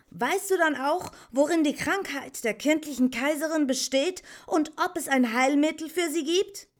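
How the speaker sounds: high, loud, urgent questioning; fast, high-voiced boy demanding an answer; young Atreyu. A narrator's reading. Atreyu questions the old Morla about the sick Empress, urgent.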